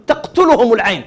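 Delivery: usual speaking voice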